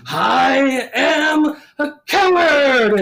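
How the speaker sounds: very slow, high, loud wail; Scarlet Pimpernel proclaiming. Retold or imitated. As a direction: drag out each word like a grand declaration. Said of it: "the Scarlet Pimpernel going".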